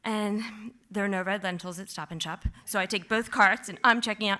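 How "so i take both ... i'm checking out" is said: ordinary talk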